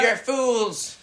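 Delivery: high, angry yelled insult; parting insult. Acted. Guest hauled out shouting at the hosts, angry. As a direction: fling the insult over your shoulder.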